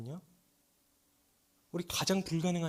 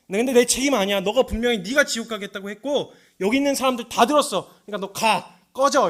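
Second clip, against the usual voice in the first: loud, higher furious yelling; angry shouting; 6 semitones higher, 12 dB louder than usual. Retold or imitated. Teacher yelling at the defiant boy to leave, loud and furious. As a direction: shout him away in rage. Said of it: "제가 너무 화가 나서".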